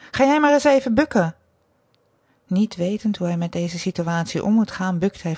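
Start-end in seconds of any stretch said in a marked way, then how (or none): 0.1-1.3 stern order, higher and louder; commanding. A narrator's reading. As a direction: order him like a strict mistress. Mistress orders the man. (streng bevelend)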